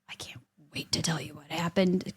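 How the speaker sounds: hushed gossipy whisper. Retold or imitated. Gossipy aside, unvoiced.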